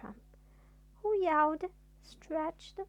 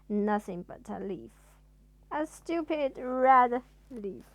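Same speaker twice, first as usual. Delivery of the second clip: ordinary delivery, nothing marked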